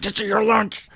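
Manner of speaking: high, tight scolding of a son over lunch; exasperated scolding. Retold or imitated. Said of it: "And I was like".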